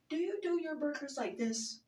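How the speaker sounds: high, prim mock-fancy question; fancy sister voice. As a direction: mimic a fancy order. Imitating sister being fancy.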